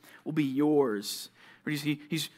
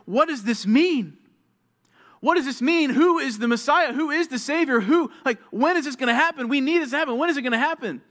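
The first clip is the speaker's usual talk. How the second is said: higher, louder, pleading questions; urgent questioning; 8 semitones higher, 4 dB louder than usual. Retold or imitated. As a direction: act out the bewildered prophets. Prophets puzzling over their prophecies.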